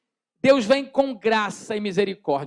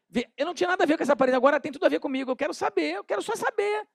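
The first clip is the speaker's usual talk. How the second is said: curious tempter voice; 6 semitones higher, 5 dB quieter, faster than usual. Pretend. Curious person tempted by sign.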